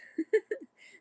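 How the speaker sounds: sudden high, loud laugh; high burst of laughter. Real, not acted. Reader giving up on the medical terms, bursting out laughing. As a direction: crack up at the jargon.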